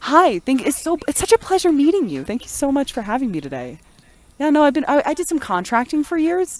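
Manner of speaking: high-pitched, polite customer service voice. Acted. Thanking an interviewer for having them, customer service voice. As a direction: greet an interviewer in a high, eager-to-please register. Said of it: "the customer service".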